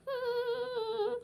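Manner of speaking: slow, soft keening moan; drawn-out wailing hum. Acted. Mournful hum between laments. (ದೀರ್ಘ ನರಳಾಟ)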